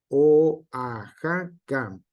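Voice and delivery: Oaxaca said slowly, the O and a split apart; slow careful demo. Shown as a demonstration. Teacher sounding out an example word for the class, slowly. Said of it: "Estas palabras que se encuentran aquí".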